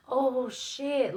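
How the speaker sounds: high startled "oh, shit"; startled gasp. Retold or imitated. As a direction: caught red-handed, blurt it out. Cousin caught by dad, startled.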